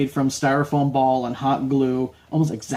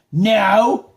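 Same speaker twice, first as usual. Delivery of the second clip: put-on higher, louder, indignant kid voice; little girl's attitude; 5 semitones higher, 5 dB louder, much slower than usual. Little knight correcting the judge, mock sassy indignation. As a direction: imitate an exasperated little girl, drawn out. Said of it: "quite the little attitude on her".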